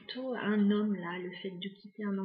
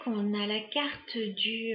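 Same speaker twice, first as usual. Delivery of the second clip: ordinary delivery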